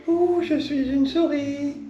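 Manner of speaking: high, slow little mouse voice; squeaky mouse voice. Pretend. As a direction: play a timid little mouse. Playing the mouse in a story.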